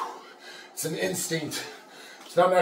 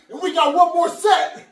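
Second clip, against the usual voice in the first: pumped-up shouting; 12 semitones higher than usual. Real, not acted. Lifter yelling to viewers how to push through pain, shouting. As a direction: fire up the viewers like a drill coach. Pep-talk explaining grunge set.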